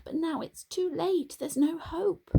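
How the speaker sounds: high, pleading repeats of tried; crying out. A narrator's reading. Donkey crying that she tried. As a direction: cry it out, giving up. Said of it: "Dilly cried".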